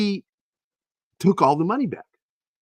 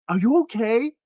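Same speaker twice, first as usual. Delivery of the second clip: high, mock English voice asking through the door; worried English lady, much higher; hammed-up English concern; English woman; 11 semitones higher than usual. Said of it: "she was English".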